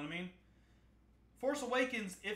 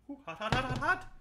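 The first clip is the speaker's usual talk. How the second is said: yelping from heat; 5 semitones higher, 7 dB louder than usual. Real, not acted. Burning hands on hot dish.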